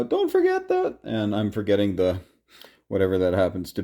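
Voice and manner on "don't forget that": much higher, playful mock shout; mock yell at the screen. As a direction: squeal it like a viewer scolding the screen. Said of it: "Triple Crown is yelling at the screen".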